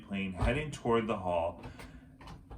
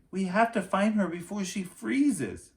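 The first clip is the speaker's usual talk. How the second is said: Annie's voice; 11 semitones higher, 5 dB louder than usual. A narrator's reading. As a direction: voice a worried little girl, up high. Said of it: "said Annie".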